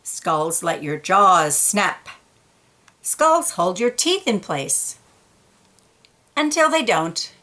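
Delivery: picture-book read-aloud voice; storybook reading for kids; story narration. A narrator's reading. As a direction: read it to a child, gently paced.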